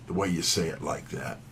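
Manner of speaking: ordinary talk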